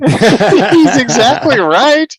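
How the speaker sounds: slow, high laughing reply. Real, not acted. Listener laughing at the story, high and slow. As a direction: laugh it out in delight.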